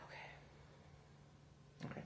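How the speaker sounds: breathy whispered aside; muttered under the breath. Real, not acted. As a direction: whisper it to yourself. Whispers to herself.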